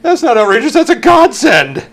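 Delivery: high, loud outburst, stretched at the end; excited exclamation. Real, not acted. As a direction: exclaim it at the top of your range. Exclaims over Playboy Mansion gift idea.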